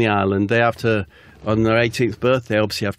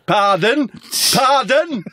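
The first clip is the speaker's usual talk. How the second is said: high shout, building higher; heckling shout; 13 semitones higher, 3 dB louder, slower than usual. Pretend. Heckler in the front row challenging the act, shouting. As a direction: shout it up at the stage. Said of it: "No, you just shout".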